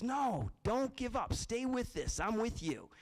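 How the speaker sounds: don't let your friend quit; lightly voiced hopeful frog; encouraging frog. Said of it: "she says".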